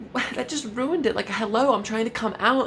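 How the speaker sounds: high-pitched exasperated what. Retold or imitated. Teen exasperated as classmates ruin his coming out. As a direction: throw your hands up in high dismay. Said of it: "And I was like".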